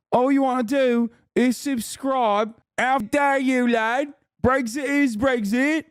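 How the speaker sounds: high-pitched, indignant caricature of a Brit; mock British accent. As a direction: put on a hammed-up British whine, drawing out "how dare you". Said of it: "British people are like".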